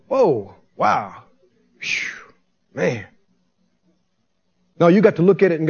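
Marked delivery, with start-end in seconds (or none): 0.1-3.1 low, quiet, stunned murmur; hushed amazement. Acted. Onlooker staring at the withered tree, hushed amazement. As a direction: marvel softly under your breath. Acting out awed onlookers marveling.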